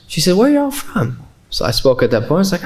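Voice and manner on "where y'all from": very high Southern woman's voice; Southern accent. Retold or imitated. Tennessee lady asking converts their origin, Southern accent. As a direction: pitch it way up with a Southern twang. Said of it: "in a very Southern accent".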